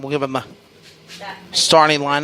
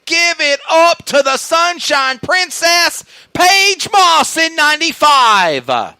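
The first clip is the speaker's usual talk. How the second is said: booming pumped announcer; 5 semitones higher than usual. Real, not acted. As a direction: boom the winner's name to the crowd. Winner announcement to crowd.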